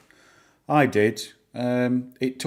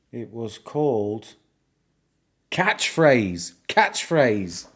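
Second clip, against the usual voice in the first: ordinary delivery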